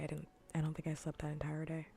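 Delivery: ordinary delivery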